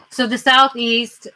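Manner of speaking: ordinary talk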